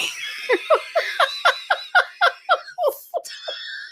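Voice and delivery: high breathless shrieking laugh; can't stop laughing; helpless laughter. Real, not acted. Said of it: "you're making me cry".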